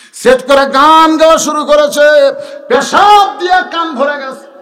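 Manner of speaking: loud, high chanted storytelling; full-force preaching. Acted. Preacher tells crowd how the ear got filled, full-force chant. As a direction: belt out the tale's climax.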